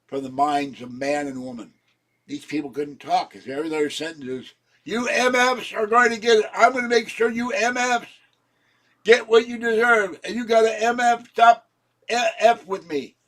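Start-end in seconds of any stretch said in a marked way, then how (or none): 4.8-13.2 mock screaming curses, much higher and louder; imitated screaming. Imitating the cursing woman downstairs, screaming threats. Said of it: "They're screaming that".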